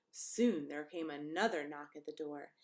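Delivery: plain reading voice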